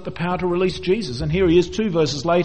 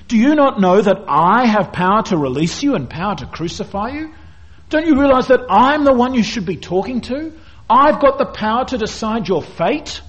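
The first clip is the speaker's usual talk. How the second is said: higher, louder, boastful retelling of Pilate; indignant Pilate; 6 semitones higher, 4 dB louder than usual. Retold or imitated. Pilate lecturing the silent Jesus on his power, pompous and raised. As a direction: puff up like an offended governor.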